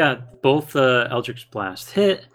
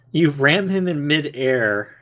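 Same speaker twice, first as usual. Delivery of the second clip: usual speaking voice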